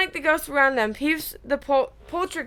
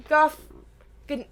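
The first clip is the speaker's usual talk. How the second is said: usual speaking voice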